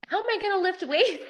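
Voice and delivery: mock frustrated whine; frustrated inner voice. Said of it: "my first thought is I'm frustrated".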